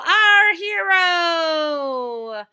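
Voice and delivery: very slow, high, loud grand mock praise; mock heroic fanfare, very slow. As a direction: draw it out like a fanfare. Mock tribute to Cloud Girl.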